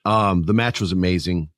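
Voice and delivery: normal talking voice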